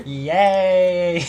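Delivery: high, loud, drawn-out cheer; excited cheer. Real, not acted. Player celebrating the lieutenant's consent, excited high cheer.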